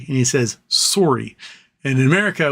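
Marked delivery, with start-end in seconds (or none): 0.7-1.4 "Sorry" with a round OR vowel; "Sorry" rhyming with story; Canadian-style sorry. Retold or imitated. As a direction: say "Sorry" as SORE-ee. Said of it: "one scene where he's ... saying the word sorry".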